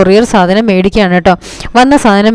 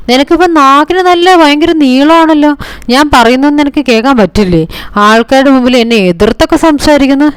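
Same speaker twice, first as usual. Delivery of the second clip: much higher, sharp imitated telling-off; mock angry rebuke; 5 semitones higher than usual. Chandra berates Revathi for answering back. (ഉയർന്ന സ്വരത്തിൽ അനുകരിച്ച ശകാരം)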